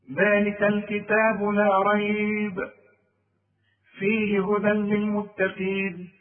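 slow recitation broken after ريب; pause after ريب. Shown as a demonstration. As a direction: chant slowly, rest on ريب, then begin at فيه. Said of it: "ثلاث طرق لقراءة هذه الآية".

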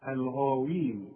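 الغاوين drawn out slowly, with the thin wrong ghain; slow wrong reading. Shown as a demonstration. Teacher to students, slowly showing the wrong الغاوين. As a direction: slowly demonstrate الغاوين as it should not sound. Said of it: "ما تقولش".

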